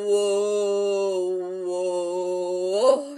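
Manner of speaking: low drawn-out whoa; pulling up the horse. Acted. Leader stopping the pretend horse, drawn-out whoa. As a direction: rein the horse in.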